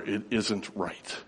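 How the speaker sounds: slow, soft, weighted words; understated great emotion. Retold or imitated. Preacher retells tearful boy's words after the film, understated emotion. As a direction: quote the boy slowly and quietly, holding back emotion. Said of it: "with great emotion, he spoke the words".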